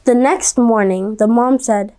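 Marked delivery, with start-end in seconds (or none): none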